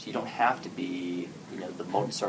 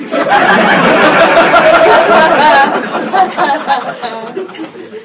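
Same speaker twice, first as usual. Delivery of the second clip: real roaring laughter, high and loud; big loud laugh; 18 dB louder than usual. Real, not acted. Speaker cracks up at the joke, loud laughter. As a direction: burst out laughing hard.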